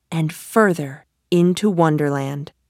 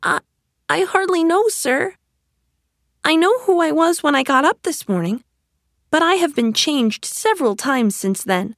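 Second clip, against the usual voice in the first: put-on high, polite, puzzled girl; little girl voice; 8 semitones higher than usual. A narrator's reading. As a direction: answer as a confused young girl. Alice's voice held across lines.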